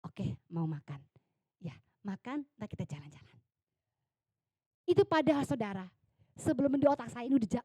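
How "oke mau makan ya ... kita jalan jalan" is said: tender coaxing. Retold or imitated. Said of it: "coaxing sick mother".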